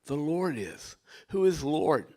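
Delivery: ordinary delivery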